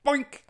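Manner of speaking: high cartoon boink; a squeaky comic bonk; a bouncy cartoon boing; boink sound effect. A narrator's reading.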